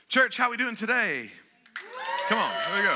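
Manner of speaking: rally the congregation with energy; loud rallying call. Shown as a demonstration. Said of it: "I need some help today from you guys".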